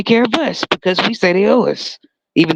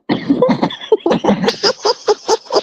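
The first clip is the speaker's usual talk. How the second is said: high wheezing laugh. Real, not acted. Cracking up.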